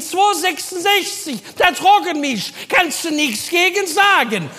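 Helga from the back. Retold or imitated. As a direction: drag it out, shouting from the back room. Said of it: "Helga von hinten".

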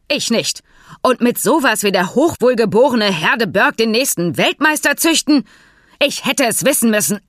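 laut und deutlich höher schimpfen; aufgebrachte Tirade. Acted. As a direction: sich in Rage reden. Fink tobt über das Pferd. (Fink schimpft wütend über die bissige Stute)

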